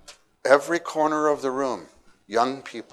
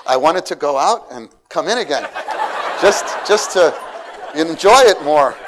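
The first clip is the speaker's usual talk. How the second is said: laughing with delight; 5 semitones higher, 10 dB louder than usual. Real, not acted.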